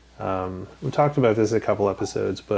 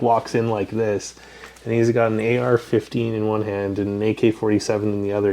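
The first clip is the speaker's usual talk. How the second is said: normal talking voice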